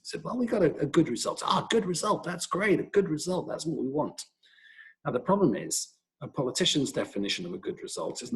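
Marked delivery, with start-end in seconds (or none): 0.2-4.2 imitated American accent. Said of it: "So I went to his accent".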